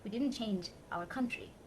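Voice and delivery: normal talking voice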